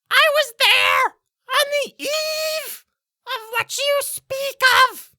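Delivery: very high, loud, drawn-out bird voice; slow screech, pitched far up; talking bird. Acted. Bird answering the player, shrill creature screech. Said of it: "and it will say".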